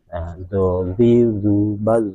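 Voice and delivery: slow teacher demo; slow emphatic demo. Shown as a demonstration. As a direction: drag out zozi, zubaz. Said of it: "lidahnya kan naik ke langit atas".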